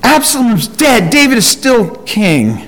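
high, cheering crowd voice; excited townspeople. Retold or imitated. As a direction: celebrate as the jubilant town. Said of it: "they're all excited, like".

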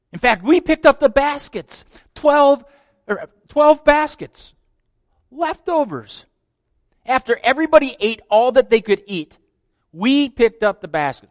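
high, loud excited recounting. Acted. Preacher recalling the leftover baskets, high and excited. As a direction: let the wonder burst out.

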